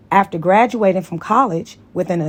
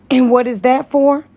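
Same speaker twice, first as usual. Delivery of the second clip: suspicious question; 6 semitones higher, 6 dB louder than usual. Acted. Tony suspicious of vibrator.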